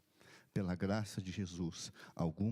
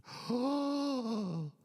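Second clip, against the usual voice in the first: one sharp, high dying gasp; a final intake of breath; last gasp; 5 dB louder than usual. Retold or imitated. Dying man's last gasp after the amen. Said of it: "e fez assim".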